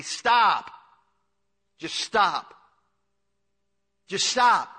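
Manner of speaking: loud, high call of stop; hollering stop. Retold or imitated. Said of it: "I just holler at them".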